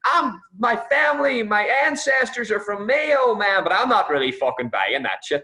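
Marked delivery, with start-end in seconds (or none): 0.1-3.6 high, slow fake American drawl; American claiming Mayo roots, mock American accent. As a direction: ham up a plastic Yank's American accent. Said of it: "he's playing the whole".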